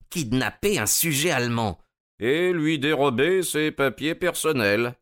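offended prisoner asserting his rights; Von Bork, indignant. Acted. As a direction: protest the outrage point by point.

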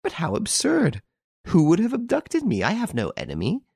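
indignant. A narrator's reading. Girl denying the accusation.

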